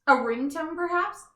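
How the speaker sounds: ordinary delivery, nothing marked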